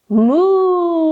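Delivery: lowing moo like a cow; yoga teacher mooing for kids in cow pose. Pretend. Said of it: "and say".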